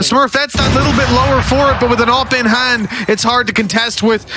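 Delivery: high, building hype play-by-play; esports caster. Real, not acted. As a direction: ride the tension of the clutch. Clutch round called live.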